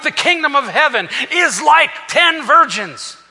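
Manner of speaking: rising high and loud through the parable; preaching build. Acted. Preacher retelling the ten virgins with mounting force.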